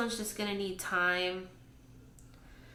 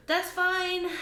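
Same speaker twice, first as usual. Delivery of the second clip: high, louder strained cheerfulness; forced cheer; 8 semitones higher, 4 dB louder than usual. Real, not acted. Vlogger insisting her rootless orchid is fine, higher forced cheer. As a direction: insist it's fine through gritted optimism.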